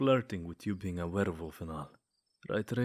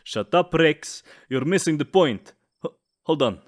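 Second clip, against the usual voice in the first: louder, higher snapping at him; irritated outburst; 5 semitones higher, 9 dB louder than usual. Acted. Vampire snaps at the werewolf rival, loud and irritated. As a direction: cut him off, annoyed and loud.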